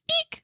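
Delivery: very high little "eek"; excited squeal. Acted. Friend signing off her ghost story, high squeal.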